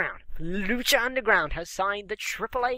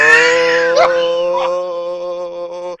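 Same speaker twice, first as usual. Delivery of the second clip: big loud burst of laughter; loud laughing yell; 3 semitones lower, 13 dB louder than usual. Real, not acted. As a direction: roar with laughter.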